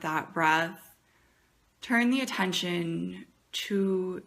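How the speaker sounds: soft, unhurried meditation voice with long pauses; guided meditation. Acted. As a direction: ease them gently into the breath.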